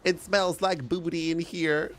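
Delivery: high Western twang; cowboy Western accent. Acted. Streamer joking as a cowboy, Western accent. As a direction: play it as a high-voiced Western yokel. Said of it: "Cowboy would be more Western".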